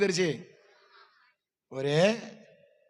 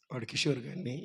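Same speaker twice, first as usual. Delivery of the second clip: ordinary delivery, nothing marked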